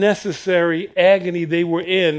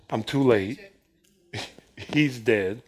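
mock despair; 7 semitones lower, 6 dB quieter than usual. Believers' defeated thought.

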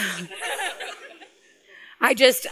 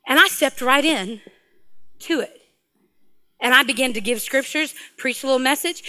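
usual speaking voice

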